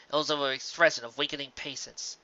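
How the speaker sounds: ordinary delivery, nothing marked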